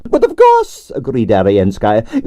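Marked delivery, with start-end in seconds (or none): 0.1-0.9 grande dame Russian ballerina. A narrator's reading. Narrator voicing Arienskaya, imperious and high. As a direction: sigh it out like a tragic prima donna.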